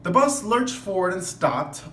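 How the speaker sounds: lively read-aloud voice; expressive storybook reading; story narration. A narrator's reading. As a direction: read it to the class like a picture book. Reads picture book to class.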